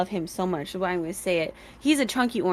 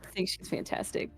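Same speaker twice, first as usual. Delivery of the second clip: whisper; 10 dB quieter than usual. Real, not acted. Teasing friend, she protests.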